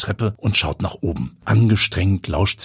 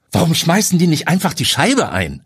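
loud, raised question; 11 semitones higher, 5 dB louder than usual. A narrator's reading. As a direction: call it out to the group. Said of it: "fragt Hannes laut".

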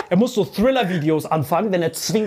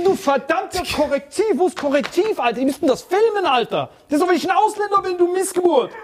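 retold angry outburst; 8 semitones higher than usual.

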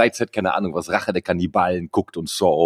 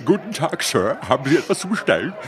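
posh British waiter voice in German; German with a British accent, high and quick; 6 semitones higher than usual. Pretend. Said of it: "der mit so einem britischen Akzent redet".

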